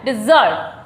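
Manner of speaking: डिजर्ट said as "di-ZURT"; short i and strong zurt in डिजर्ट; word demo. Shown as a demonstration. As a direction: model डिजर्ट with a falling second syllable. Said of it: "उसको बोलते हैं".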